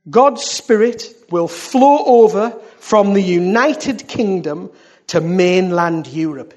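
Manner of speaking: loud, slow, weighty proclamation; prophetic declamation. Real, not acted. Preacher proclaims the Spirit spreading to Europe, prophetic declamation. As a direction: stretch out each phrase as a proclamation. Repeats prophecy line at full force.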